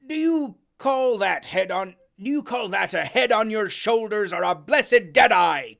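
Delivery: loud scornful sailor; crying out. Acted. Long John berates Morgan, loud. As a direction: sneer and shout it. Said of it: "cried Long John".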